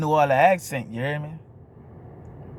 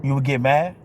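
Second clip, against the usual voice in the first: ordinary delivery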